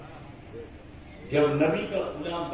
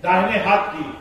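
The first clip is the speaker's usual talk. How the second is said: louder command to roll up sleeve; loud request; 4 semitones higher, 8 dB louder than usual. Acted. Old man tells Umar to bare arm, loud. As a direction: call out the order loudly. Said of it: "عمر سے کہا".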